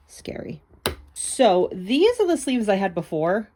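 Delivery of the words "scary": breathy mock-scared whisper. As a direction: breathe it like a spooky secret. Mock fright at binder page.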